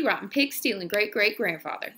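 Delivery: plain reading voice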